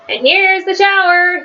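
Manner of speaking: high, storybook mock shout; shouted announcement. A narrator's reading. Alkaloo arriving with the hose, high shouted announcement. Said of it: "he shouted".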